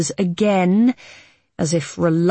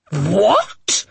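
shocked outburst; 4 dB louder than usual. A narrator's reading. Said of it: "his voice breaking into the hush".

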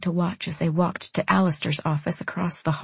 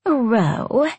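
put-on sweet, sing-song Valley Girl; 5 semitones higher than usual. A narrator's reading. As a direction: coo his name like a Valley Girl. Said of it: "sweet Valley Girl voice".

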